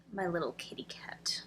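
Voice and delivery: soft unvoiced whisper; whisper to the cat. Real, not acted. Whispering to her cat, hushed and tender. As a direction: breathe it tenderly to the pet.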